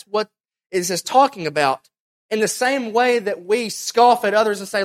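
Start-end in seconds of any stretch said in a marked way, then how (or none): none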